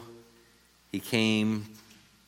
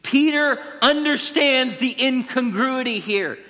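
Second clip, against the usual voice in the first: ordinary talk, nothing marked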